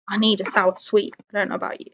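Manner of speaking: ordinary talk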